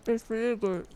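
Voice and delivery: talking through a mouthful; mouth full. Real, not acted. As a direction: speak around a bite of food. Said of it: "not eat with your mouth full".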